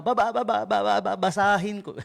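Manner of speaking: imitating the stutter customers hear; stuttering pitch. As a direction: stammer the offer again. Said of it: "pag narinig ng mga tao".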